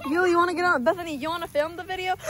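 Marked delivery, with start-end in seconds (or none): none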